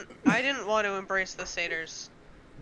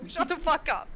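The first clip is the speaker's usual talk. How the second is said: laughing; 8 semitones higher, 4 dB louder than usual. Real, not acted. Laughing disbelief at a roll.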